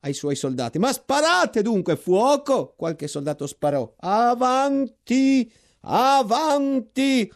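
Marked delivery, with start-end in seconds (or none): 0.8-2.7 high loud order to fire; shouting. A narrator's reading. Lieutenant yelling at his soldiers to open fire, loud high command. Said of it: "gridò". 4.0-7.3 very slow, high, drawn-out roar; yelling. A narrator's reading. General yelling his men forward, slow drawn-out high shout. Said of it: "urlava il generale".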